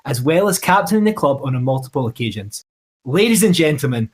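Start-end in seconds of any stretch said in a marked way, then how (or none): 3.0-4.1 announcer intro. Acted. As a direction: hype up the guest's entrance. Introducing the guest with fanfare.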